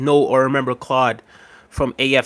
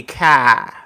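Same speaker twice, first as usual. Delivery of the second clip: Boston accent. Shown as a demonstration. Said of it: "And they talk like that".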